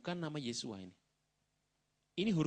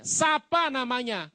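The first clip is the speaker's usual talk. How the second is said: eager question; 10 semitones higher, 10 dB louder, much slower than usual. Retold or imitated. People eagerly ask the name.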